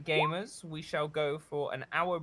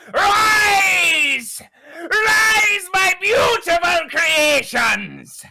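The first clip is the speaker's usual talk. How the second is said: screaming, much higher and louder, slow; villain's roar; 11 semitones higher, 14 dB louder, much slower than usual. Acted. Captain commanding his dead crew to rise, screaming. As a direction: summon your creations with a mad roar.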